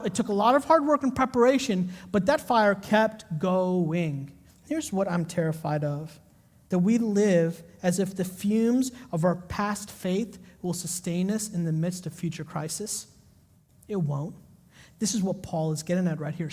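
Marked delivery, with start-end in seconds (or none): 4.7-16.5 low, soft, earnest sermon; solemn preaching. Acted. Pastor warning the congregation, soft and earnest. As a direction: draw them in quietly.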